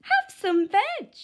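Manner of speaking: loud high hoot, slow; a cheerful hooting welcome. A narrator's reading. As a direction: hoot a friendly offer. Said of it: "and they hooted".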